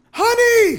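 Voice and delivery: very high, loud scream; wife's piercing shriek. A narrator's reading. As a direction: scream it right into his ear. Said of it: "she screamed into his ear".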